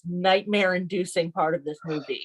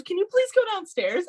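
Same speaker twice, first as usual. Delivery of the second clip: high-pitched mock pleading; exasperated plea; 12 semitones higher than usual. Wife asking house guests to leave the landing, exasperated plea. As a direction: put on a much higher voice for a polite plea.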